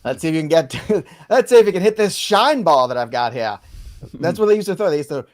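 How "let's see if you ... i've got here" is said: put-on loud old-timey pitcher jeer; old-timey baseball voice. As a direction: taunt like a 1920s pitcher. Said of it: "old-timey baseball announcer voice".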